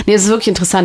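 ordinary delivery

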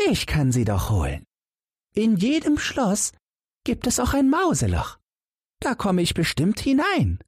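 very high, tiny squeaky mouse; a helpful mouse offering eagerly; little mouse voice. A narrator's reading. Mouse character voice.

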